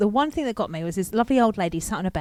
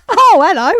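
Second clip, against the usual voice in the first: high, loud surprised greeting; startled hello; 12 semitones higher, 11 dB louder than usual. Retold or imitated. Caller put straight through to the radio show, startled. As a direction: answer as if caught off guard, pitched right up.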